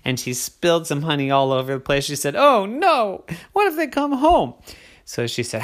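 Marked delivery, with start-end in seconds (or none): 2.3-4.5 worried. Acted. Ollie fears being caught.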